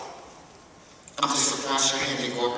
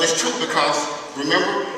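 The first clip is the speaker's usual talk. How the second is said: ordinary talk, nothing marked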